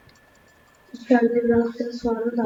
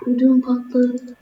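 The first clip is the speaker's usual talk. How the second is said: normal talking voice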